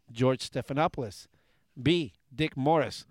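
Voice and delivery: ordinary talk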